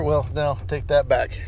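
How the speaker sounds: ordinary talk, nothing marked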